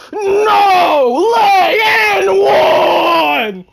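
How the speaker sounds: slow stretched and-one call, loud; drawn-out hype shout. Real, not acted. Streamer celebrating an and-one, stretched loud call. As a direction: stretch it out like a crowd chant.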